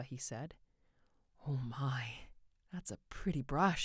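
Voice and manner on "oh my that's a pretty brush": breathed admiring exclamation; exclaimed. A narrator's reading. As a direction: start hushed, then exclaim over it. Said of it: "she exclaimed".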